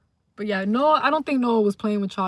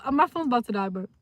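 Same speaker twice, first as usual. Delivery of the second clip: ordinary delivery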